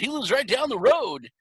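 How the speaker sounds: mock rock frontman teasing the audience; imitated stage banter. Musician hints to the crowd about a guest, imitated excitement.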